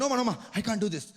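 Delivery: rattled off very fast, lightly voiced Indian uncle; fast nervous refusal. Indian man refusing a confident stripper, fast nervous refusal. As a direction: gabble the refusal. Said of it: "he was like".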